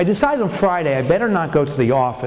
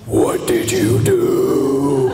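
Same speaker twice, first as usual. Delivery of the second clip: slow, deep, menacing wife; 5 semitones lower, much slower than usual. Retold or imitated. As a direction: drag it out like a monster. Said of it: "I've never heard a voice this deep".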